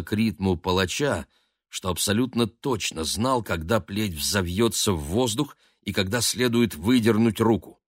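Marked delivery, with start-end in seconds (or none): none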